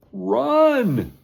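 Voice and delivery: loud, high panicked yell; high loud warning shout. Acted. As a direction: scream it to flee the beast. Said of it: "Hugo shouted".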